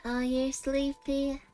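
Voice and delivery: ordinary delivery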